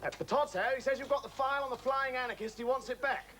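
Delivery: high, insistent relayed message; calling across. Acted. Relaying phone message across the room.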